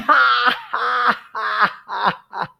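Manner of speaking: high-pitched, drawn-out "hah" cackle; imitated boy's laugh. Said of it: "started laughing".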